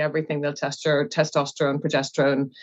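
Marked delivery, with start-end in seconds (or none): none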